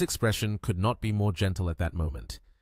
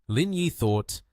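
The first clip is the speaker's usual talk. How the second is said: plain reading voice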